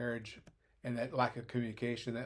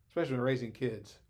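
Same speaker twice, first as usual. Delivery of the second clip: ordinary talk, nothing marked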